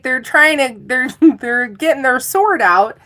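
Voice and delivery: ordinary delivery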